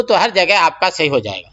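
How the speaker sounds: ordinary delivery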